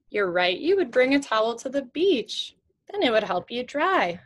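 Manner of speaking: ordinary talk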